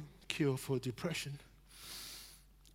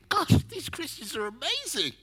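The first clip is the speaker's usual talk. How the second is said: impressed steward; 11 semitones higher than usual. Retold or imitated. Voicing stewards' impressed thought.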